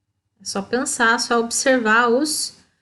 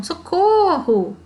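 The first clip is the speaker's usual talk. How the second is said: higher, slow drawn-out socorro; mock cry for help; 7 semitones higher, much slower than usual. Shown as a demonstration. Teacher acts out a frightened cry for pupils. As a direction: call for help, hammed up for class. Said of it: "assustado, medo".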